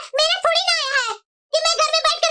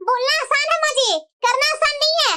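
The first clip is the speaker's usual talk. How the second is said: ordinary talk, nothing marked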